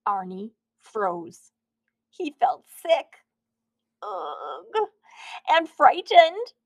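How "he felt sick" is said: usual speaking voice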